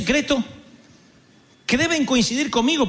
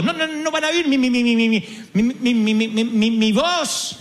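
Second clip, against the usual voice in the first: stuttering Moses; 2 semitones higher than usual. Retold or imitated. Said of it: "y encima era tartamudo". (Moisés tartamudeando)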